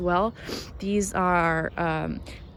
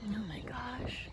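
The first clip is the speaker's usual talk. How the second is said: slow awed whisper; 13 dB quieter, slower than usual. Real, not acted. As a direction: stretch the whispered oh my gosh. Another find, whispered.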